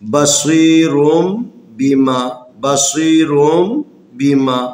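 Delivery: بصیرم بیما slowly, the n read as a clear m; slow careful بصیرم with an m before بیما; n turned to m. Shown as a demonstration. Teacher models بصیرم بیما slowly for students. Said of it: "نون کی آواز کو میم کی آواز میں بدلنا".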